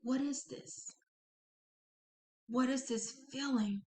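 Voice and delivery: soft, inward questioning; thinking to oneself. Acted. Thinking to yourself as a dark presence arrives, soft inner questioning. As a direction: quietly wonder it to yourself. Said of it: "you're thinking to yourself".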